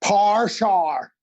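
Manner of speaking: exaggerated Northern Irish "par shar"; Northern Irish accent. Shown as a demonstration. Actor teasing Irish friends, broad Northern Irish accent. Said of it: "My apologies to all my Irish friends".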